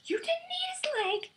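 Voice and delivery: very high squeaky disbelief; mock incredulous Quill. Quill objecting to Rocket about the leg, squeaky high. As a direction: squeal it in outrage. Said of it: "quoting Quill".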